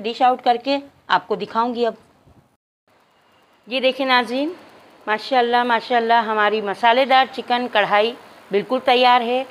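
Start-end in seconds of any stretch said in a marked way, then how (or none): none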